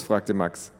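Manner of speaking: ordinary delivery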